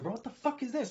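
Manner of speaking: shocked disbelief. Retold or imitated. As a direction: blurt it out in sudden disbelief. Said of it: "I was like".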